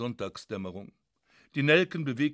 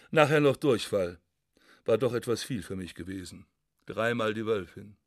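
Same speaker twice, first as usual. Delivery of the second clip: ordinary delivery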